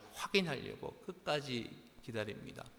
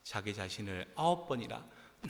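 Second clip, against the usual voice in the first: ordinary delivery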